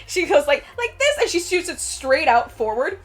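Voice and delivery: usual speaking voice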